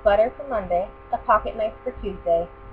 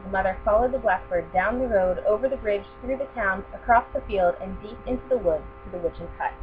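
usual speaking voice

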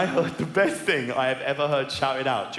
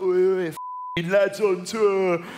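loud, drawn-out laddish call; proper geezer; 5 semitones higher, 4 dB louder, slower than usual. Retold or imitated. Comic imitating his geezer mate, loud slow laddish chant. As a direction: holler it slowly like a geezer. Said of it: "who's like a proper, like, geezer".

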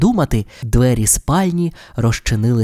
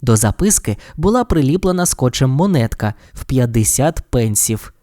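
usual speaking voice

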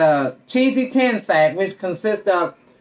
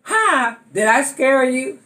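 startling boo; 6 semitones higher, slower than usual. Acted. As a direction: jump out and yell it. Jumps out after fake scare.

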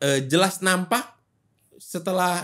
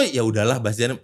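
indulgent shrug; 8 semitones lower, 4 dB quieter than usual. Retold or imitated. Voicing forgiving people.

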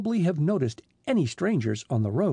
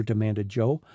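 ordinary delivery, nothing marked

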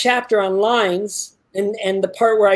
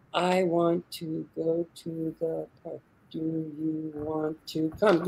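slow, low, much softer rhythmic delivery; robotic monotone; 5 semitones lower, 11 dB quieter, much slower than usual. Shown as a demonstration. Acting coach drilling both lines in slow robotic monotone. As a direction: drone the two lines evenly without feeling. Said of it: "say it in a disinterested, monotone, robotic, rhythmic voice".

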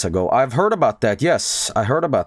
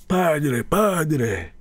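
imitating the Pope; 5 semitones higher than usual. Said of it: "and he's like".

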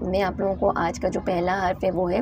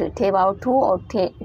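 ठे वाव ठू और ठे spelled letter by letter, slow; slow spelling of the word; spelling out slowly; slower than usual. Shown as a demonstration. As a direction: spell it out so kids can follow. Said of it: "उसका sound आएगा ठूठ".

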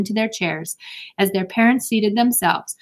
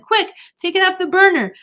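high, raised-voice command; urgent; 11 semitones higher, 5 dB louder than usual. Acted. Sister orders the pan off the burner, urgent. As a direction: urge someone to act at once.

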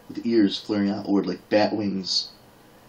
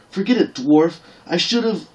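high, loud furious snap; an insulted warrior maid losing her temper; angry outburst; 9 semitones higher, 6 dB louder than usual. Acted. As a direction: fling the words at him before she's cut off. Ellen furious at the dwarf.

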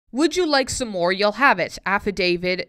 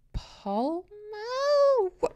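baffled; 12 semitones higher, 4 dB quieter, much slower than usual. Real, not acted. Reading the poem, baffled.

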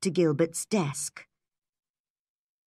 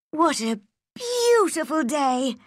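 high-pitched, delighted exclamation; bright-hearted girl greeting a lovely day; happy; 9 semitones higher than usual. A narrator's reading. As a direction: voice lifts with a happy little girl's delight. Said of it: "Anne said happily".

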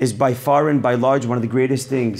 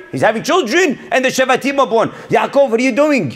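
fiery preaching; 9 semitones higher than usual. Acted. Rabbi exhorting his listeners, preaching at full force. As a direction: lift the voice and preach it out to the congregation.

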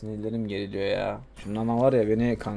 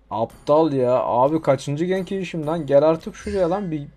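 furious rant; 5 semitones higher, 4 dB louder than usual. Real, not acted. Streamer ranting at his jungler, loud high furious voice. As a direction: rage at a useless teammate.

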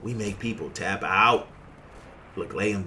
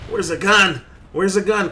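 loud, high urgent question; frantic searching; 8 semitones higher, 9 dB louder than usual. Real, not acted. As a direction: call out in a panic for a weapon. Out of ammo mid-fight.

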